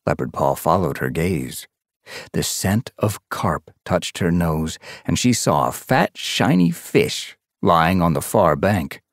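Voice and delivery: plain reading voice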